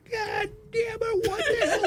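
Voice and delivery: high, drawn-out mock exasperation; exasperated outburst. Prank victim cursing the caller, high-pitched mock exasperation. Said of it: "it would build up to where he was going".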